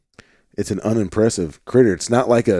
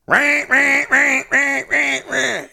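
duck hail call; slower than usual. Shown as a demonstration. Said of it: "the hail call".

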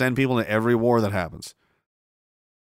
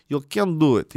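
ordinary delivery

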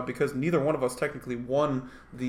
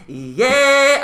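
loud yeah; 12 semitones higher, 12 dB louder than usual. Real, not acted. Loud exclamation after jingle.

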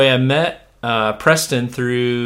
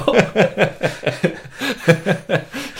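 long laugh going up high; 9 semitones higher than usual. Real, not acted. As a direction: let out a rising, high laugh. Laughs with his guest.

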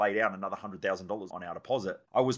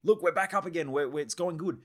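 much higher, gushing relief; excited; 7 semitones higher than usual. Retold or imitated. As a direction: blurt out the good news, tripping over words. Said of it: "I would get excited again".

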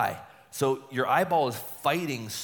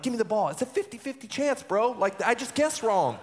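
cocky brush-off; 11 semitones higher, 3 dB louder than usual. Retold or imitated. Brushing off catcher's worried look.

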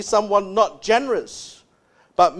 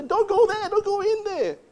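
much higher, alarmed warning to the screen; moviegoer warning; 12 semitones higher than usual. Retold or imitated. Viewer warning a horror film character, high alarmed pleading. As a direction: call out to the movie character, voice jumped way up. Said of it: "You say".

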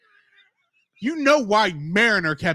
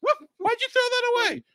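very high, fast startled question; mock shock; faster than usual. Character startled at the tablet being tossed, high mock shock. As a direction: act out alarm in a squeaked-up pitch.

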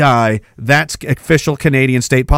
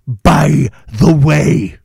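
by the way, drawn out very slowly and heavily; slow sarcastic aside; 3 semitones lower, much slower than usual. Shown as a demonstration. Host repeating the tax news, slow deadpan sarcasm. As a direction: stretch by the way out with dry sarcasm. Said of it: "Oh, oh, by the way".

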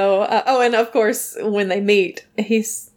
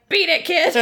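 higher-pitched, comic shooing; mock dismissal; 8 semitones higher than usual. Joe chasing a kid off the beach chair, comic dismissal. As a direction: shoo the child off, half laughing. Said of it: "And he's like".

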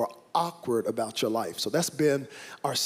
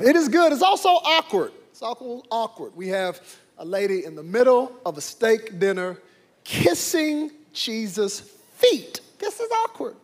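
much higher, squeaky comic delivery; mock high voice; 9 semitones higher than usual. Pastor jokes to congregation about awkward dinner, high squeaky. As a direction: squeal it for laughs.

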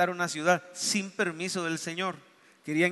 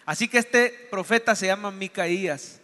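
ordinary talk, nothing marked

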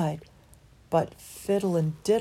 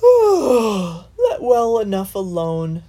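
slow lazy drawl; 3 semitones higher, 10 dB louder, much slower than usual. A narrator's reading. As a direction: stretch it out lazily. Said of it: "drawled Lazybones".

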